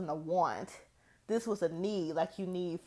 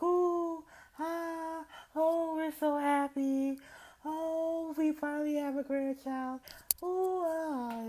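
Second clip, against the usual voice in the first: high, cooing over-the-moon parents; cooing grandparents; 9 semitones higher than usual. Retold or imitated. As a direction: gush like delighted new grandparents, pitched way up. Parents' joy voiced, high and far.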